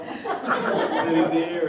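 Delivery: slow words stretched by laughter; laughing. Real, not acted. Remembering waiting half an hour, laughing loudly.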